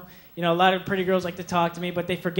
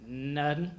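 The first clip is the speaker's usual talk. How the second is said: low, flat grunt of a guy; bored guy; 5 semitones lower, 7 dB quieter than usual. Retold or imitated. Boyfriend answering his girlfriend's text, low and curt. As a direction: give her nothing, low and short. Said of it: "Guy responds".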